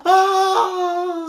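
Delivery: high, long drawn "ah" of frustration; exasperated wail. Acted. Child losing patience over the wifi code, wailing. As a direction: let out a sustained exasperated cry. Exasperated by parent's typing mistakes.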